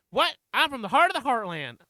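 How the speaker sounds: high, loud Southern holler; Southern accent. Acted. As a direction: call out to an old woman in a brash Southern accent. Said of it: "that southern accent".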